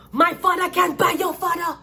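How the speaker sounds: put-on high, loud bratty taunt from a spoiled rich boy; shrill-pitched mock of a rich boy showing off; spoiled rich kid. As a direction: sneer it like a rich brat bragging about daddy.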